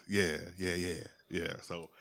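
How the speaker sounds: usual speaking voice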